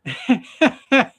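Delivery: a burst of loud, high laughter; cracking up at the punchline; real laugh. Real, not acted. The storyteller laughs at his own fishing joke, a loud laugh.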